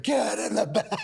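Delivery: high, loud laughing shout; retold urgent yell. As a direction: bark the order with a laugh. Yelling at arriving son.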